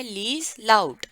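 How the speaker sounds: लीज loud said slowly and loud, like an order; commanding order. Shown as a demonstration. Teacher shows the instruction as a command for kids. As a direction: give it as an order to children. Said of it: "एक तरीके का order है".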